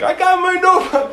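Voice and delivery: very high, cartoonish boyish squeal; mock-oblivious kid crowing with excitement; sixteen-year-old boy. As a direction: pipe it up high like an elated teen. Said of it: "like, sixteen-year-old Bacher".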